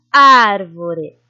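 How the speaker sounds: árvore with a loud, drawn-out ár; stressed first syllable. Shown as a demonstration. Teacher presenting the word for analysis, holding ár in árvore. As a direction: lean hard on the start of árvore. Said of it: "qual a sílaba mais forte?".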